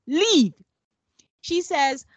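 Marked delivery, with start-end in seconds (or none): 0.1-0.5 louder one-word order; sharp command. Retold or imitated. Podcaster voicing Michaela ordering Zach out, sharp. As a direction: throw the word at him. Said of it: "She tells him".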